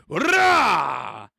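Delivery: loud, drawn-out "rah" roar; battle roar. Acted. Imitates captain landing in battle.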